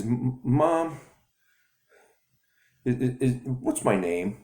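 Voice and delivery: put-on anxious boy stumbling to his mom; stuttering boy. Boy asking his mother his name, high stammer. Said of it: "And he stutters".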